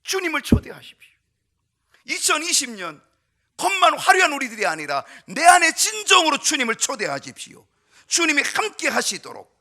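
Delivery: loud, high fervent call to the congregation; preaching at full force. Acted. Pastor urging his flock, loud fervent preaching. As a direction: plead with the whole room to invite the Lord.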